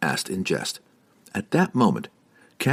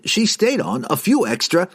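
fussy wizard; 12 semitones higher than usual. Acted. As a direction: fuss through it. Wizard voiced.

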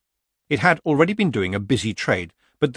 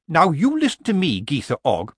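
stern scolding; 7 semitones higher, 4 dB louder than usual. A narrator's reading. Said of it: "waved a finger sternly".

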